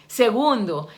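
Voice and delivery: usual speaking voice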